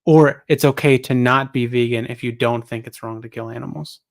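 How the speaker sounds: ordinary talk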